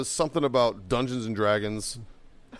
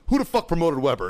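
raised-pitch indignant question; angry demand; 5 semitones higher, 4 dB louder than usual. Retold or imitated. Marine storming into first sergeant's office, angry demand. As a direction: burst in demanding an answer.

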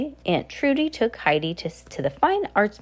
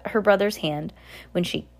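plain reading voice

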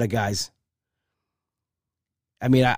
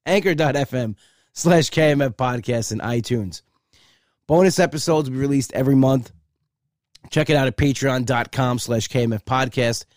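ordinary talk

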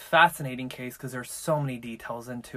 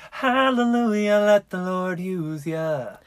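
preacher voice; 5 semitones higher, 7 dB louder, slower than usual. Pretend. Mock churchy praise.

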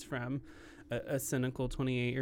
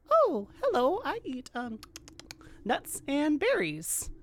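much higher squeaky critter voice; little squirrel; 11 semitones higher than usual. Acted. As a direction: go tiny and high, a timid squirrel. Voicing the squirrel.

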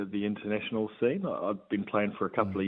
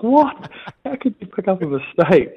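a much higher, louder stunned squawk; shocked disbelief; 11 semitones higher, 9 dB louder than usual. Retold or imitated. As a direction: replay your own shock at Dad's phone call. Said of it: "I'm like".

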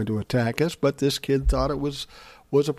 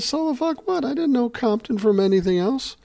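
sharply higher, incredulous retort; exasperated disbelief; 11 semitones higher than usual. Retold or imitated. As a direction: squeal it out in baffled disbelief. Narrator retorts about Compton.

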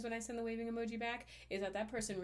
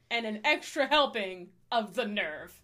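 mock outrage; 3 semitones higher, 11 dB louder, slower than usual.

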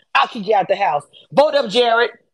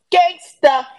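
emphatic shout; 7 semitones higher, 4 dB louder than usual. Real, not acted. Hyping Siri as a gangster.

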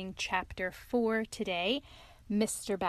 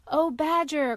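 high, loud plea at the door; rat crying out; 6 semitones higher, 7 dB louder than usual. A narrator's reading. Lost rat crying out to Badger at his door, high and loud. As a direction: call out to be let in, pitched up. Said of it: "cried the rat".

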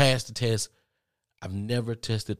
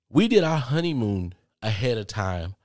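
ordinary talk

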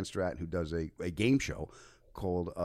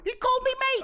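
very high, loud squeal; retold fan freak-out, pitched way up; starstruck shriek; 6 dB louder than usual. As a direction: squeal it in giddy disbelief. Retold freak-out after muting phone.

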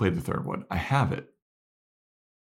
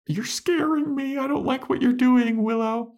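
much higher, mock frightened; scared Dawn voice. Podcaster as Dawn afraid of Willow, high and scared. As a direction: squeak like a scared kid sister.

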